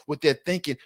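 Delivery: normal talking voice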